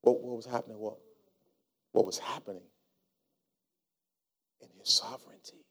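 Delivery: hushed, intimate sermon close; soft preaching over music. Acted. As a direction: draw them in quietly.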